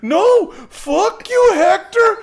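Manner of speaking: very high, loud crying shriek with a laugh breaking in; laughing while imitating the girlfriend's shrieking cry; mock hysterical shriek. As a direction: overplay her sobbing scream at Hector. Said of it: "she's like".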